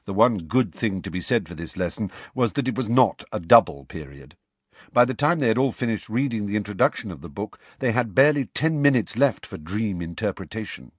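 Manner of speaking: ordinary delivery, nothing marked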